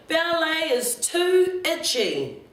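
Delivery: high, slow whine of protest; loud childish complaint. A narrator's reading. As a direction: complain for the whole room to hear, without really shouting. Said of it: "But I was a bit loud".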